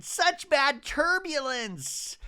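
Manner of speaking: high, slow, dramatic mimicry; a hint of a dramatic castmate; mock Shannon. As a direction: ham up the drama lightly. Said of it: "And Shannon going".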